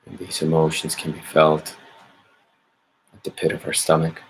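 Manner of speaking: calm, measured meditation guidance with long pauses; guided meditation. Acted. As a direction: gently lead listeners inward, leaving space after each phrase. Leads a meditation.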